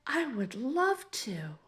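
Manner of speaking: a shy, delighted acceptance; smiling happily. A narrator's reading. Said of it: "Ellie smiled so wide and said".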